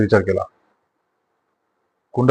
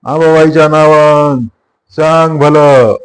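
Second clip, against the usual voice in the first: slow, drawn-out, louder roar; roaring war cry; 3 semitones higher, 4 dB louder, much slower than usual. Acted. Kabira calling on Ambabai for revenge, slow roar. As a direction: roar it out after striking the stone. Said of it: "गरजला".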